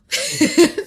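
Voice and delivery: high, loud laugh; burst of laughter. Real, not acted. Laughs at leader's reply.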